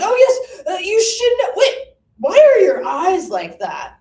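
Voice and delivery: put-on high, loud old gentleman ghost; hammed-up Benjamin Franklin ghost. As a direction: play the pompous founding father. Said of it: "he's like".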